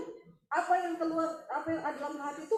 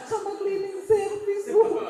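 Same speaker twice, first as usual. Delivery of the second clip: high, loud, slow comic whine; mock confession; 7 semitones higher, 9 dB louder, slower than usual. Woman blaming the cleaner to the pastor, hammed-up. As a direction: stretch it out as an absurd complaint.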